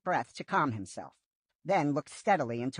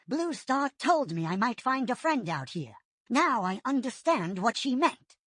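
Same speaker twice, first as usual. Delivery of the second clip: higher, thoughtful warrior voice; lightly voiced Whitestorm; 8 semitones higher than usual. A narrator's reading. Whitestorm understanding the leader's hint, lightly higher. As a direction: realize what the leader meant.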